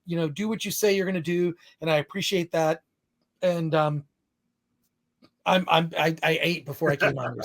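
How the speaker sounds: normal talking voice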